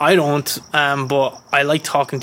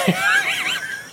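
much higher laughing shriek. Real, not acted. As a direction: burst out in a squealing laugh. Reacting to potatoes joke.

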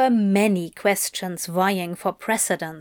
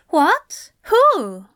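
high, startled questions, louder; alarmed angel; 7 semitones higher, 5 dB louder than usual. A narrator's reading. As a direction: blurt it out in alarm, pitched up. Angel alarmed at being seen.

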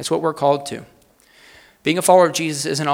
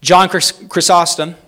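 usual speaking voice

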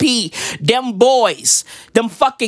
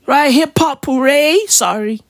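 ordinary talk

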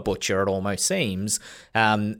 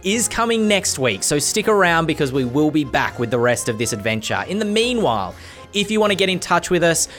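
podcast outro; 6 semitones higher, 3 dB louder than usual. Acted. Podcast outro over music.

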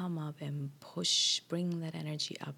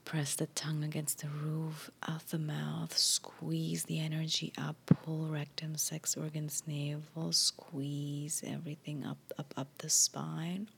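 guided breathing. Acted. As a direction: coach the breath calmly.

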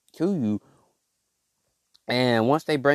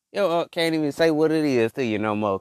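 ordinary delivery

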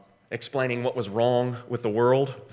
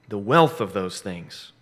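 ordinary talk

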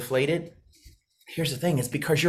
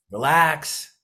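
usual speaking voice

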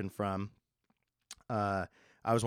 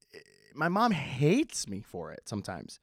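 ordinary talk, nothing marked

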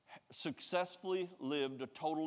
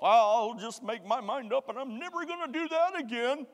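mock vow; 8 semitones higher, 6 dB louder than usual. Mock resolve of a believer.

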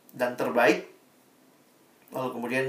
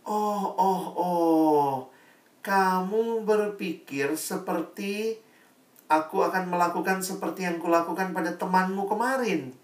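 king realizing; 6 semitones higher than usual. Acted. King finally grasps what the breeder wants, knowing.

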